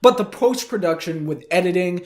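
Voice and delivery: usual speaking voice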